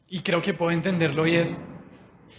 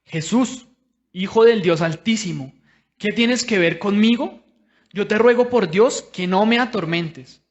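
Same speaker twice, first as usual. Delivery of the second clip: loud, raised-pitch scripture cry; proclaimed outcry, pushed louder; crying out loudly; 4 semitones higher, 7 dB louder than usual. A narrator's reading. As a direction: read it out like a loud plea to Jesus. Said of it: "a voz en cuello le dijo".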